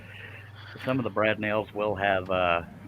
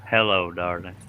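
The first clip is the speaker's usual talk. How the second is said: sexy voice; 4 semitones lower than usual. Pretend. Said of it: "That's just terrible".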